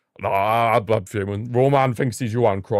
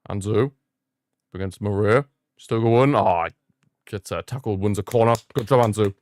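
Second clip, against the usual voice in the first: normal talking voice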